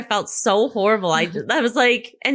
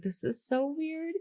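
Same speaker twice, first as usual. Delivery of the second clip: much softer, slow, a touch higher; hushed; 4 semitones higher, 12 dB quieter, much slower than usual. Retold or imitated. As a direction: say it half under breath. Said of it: "I was like".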